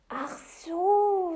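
high, loud, drawn-out realisation; dawning relief. Acted. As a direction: let the penny drop with relief.

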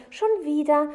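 high, loud exclamation; excited pointing-out. Acted. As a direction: exclaim it as proof. Boy points out running water.

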